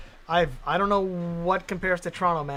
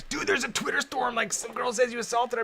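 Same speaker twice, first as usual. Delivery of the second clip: higher, excited mock friend; friend breaking news; 7 semitones higher than usual. Friend tells him about the Twitter storm, mock excited and high. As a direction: play a friend rushing in with news. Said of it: "I wake up and there's".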